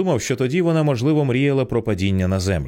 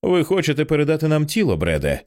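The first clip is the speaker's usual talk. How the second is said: controlled, businesslike assurance without panic; even, confident voice; 6 semitones higher than usual. A narrator's reading. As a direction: ask it steadily, keeping yourself in hand. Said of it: "Рівний, впевнений голос".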